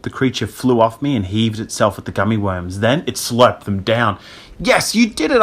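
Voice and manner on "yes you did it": high-pitched relief; elated, high exclamation; triumphant cry. A narrator's reading. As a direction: cheer the rescue. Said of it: "I cried".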